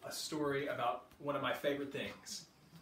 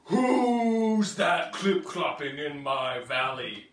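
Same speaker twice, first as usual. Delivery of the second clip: loud, gruff T-Rex voice; roaring T-Rex; 2 semitones lower, 8 dB louder than usual. Acted. T-Rex challenging the little triceratops, loud monster voice. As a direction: boom it like a big dinosaur. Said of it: "the T-Rex roared".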